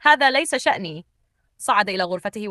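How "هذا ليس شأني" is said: higher-pitched, pitiless retort; merciless. A narrator's reading. Husband to wife cast into rain, merciless. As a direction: brush her plea off heartlessly. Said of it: "رد بلا رحمة".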